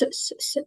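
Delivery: long voiceless ssss; hissed S. Shown as a demonstration. Teacher hissing the S sound for the class. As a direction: hiss the S sound, no voice. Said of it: "le son S".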